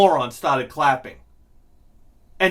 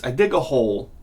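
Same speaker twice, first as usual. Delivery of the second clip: ordinary delivery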